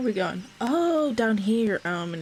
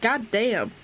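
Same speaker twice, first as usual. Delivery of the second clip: ordinary talk, nothing marked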